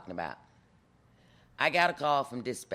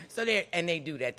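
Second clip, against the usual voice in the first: usual speaking voice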